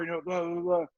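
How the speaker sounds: usual speaking voice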